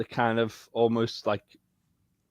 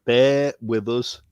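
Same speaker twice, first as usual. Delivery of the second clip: slow teasing emphasis; much slower than usual. Shown as a demonstration. Repeating his catchphrase to the host, slow and teasing. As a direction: bear with us drawn out slowly. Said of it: "we told you to bear with us".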